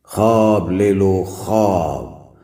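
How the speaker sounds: slow, drawn-out hawker's call; street vendor's cry. Acted. Junk seller calls in the square, drawn-out cry. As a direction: call out your wares, stretching each word. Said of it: "चौक में जाकर लगाता हूँ सदा".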